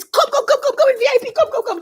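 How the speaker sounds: high, insistent mock call to come over; imitated urgent beckoning. Host spots her at the parade, imitated high urgent beckoning.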